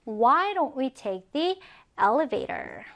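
very slow question; slow example reading. Shown as a demonstration. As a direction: read it slowly, rising on why. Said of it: "엘리베이터를 타는 게 어때?". (천천히 질문 읽기)

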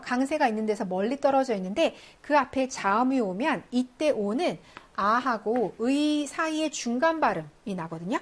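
ordinary talk

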